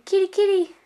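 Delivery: high, loud kitty kitty call; sing-song call like calling a cat. Shown as a demonstration.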